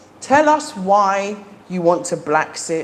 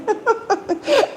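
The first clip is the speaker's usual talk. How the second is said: high, bubbly laugh at her own excitement; real happy laugh; excited giggle. Real, not acted. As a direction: giggle it off, a little embarrassed. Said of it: "Sorry, I'm so excited".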